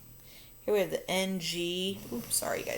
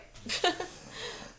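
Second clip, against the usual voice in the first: short, breathy chuckle; small laugh; 11 semitones higher, 3 dB quieter than usual. Real, not acted. Teacher catching her own mistake, small embarrassed laugh. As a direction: laugh it off after a slip.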